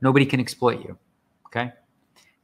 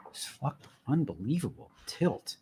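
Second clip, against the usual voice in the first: ordinary talk, nothing marked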